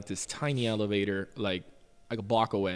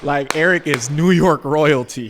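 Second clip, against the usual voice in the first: loud, high, laughing warning; laughing disbelief; 5 semitones higher, 14 dB louder than usual. Retold or imitated. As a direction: laugh while telling him he's doomed. Galdi laughing at his mess.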